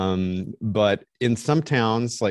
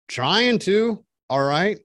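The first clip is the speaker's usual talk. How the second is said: exasperated reply; 10 semitones higher, slower than usual. Retold or imitated. Clerk asks him to leave.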